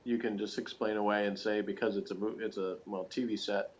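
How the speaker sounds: ordinary talk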